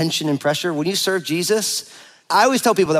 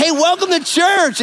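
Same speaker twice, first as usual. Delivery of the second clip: bright, much higher, slow welcome; cheery greeting; 12 semitones higher, 4 dB louder, slower than usual. Retold or imitated. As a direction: sing out the welcome to newcomers. Greeting people at church door.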